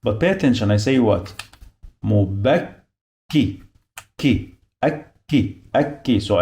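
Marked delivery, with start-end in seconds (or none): 2.0-6.2 "Mubakki" slowly split between two Ks; doubled K. Shown as a demonstration. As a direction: stop on "bak", then release "ki". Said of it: "I pronounce the, this letter two times".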